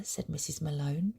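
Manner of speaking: plain reading voice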